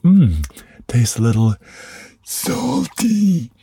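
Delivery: high lisping cartoon sloth voice, way up in pitch; Sid the Sloth voice. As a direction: squeak it out like the goofy sloth. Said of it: "Sid the Sloth ... where he's like".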